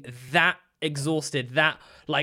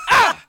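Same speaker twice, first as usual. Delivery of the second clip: sudden high loud yelp; yelp of pain; 10 dB louder than usual. Retold or imitated. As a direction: yelp as if stepping on Lego. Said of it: "just being like".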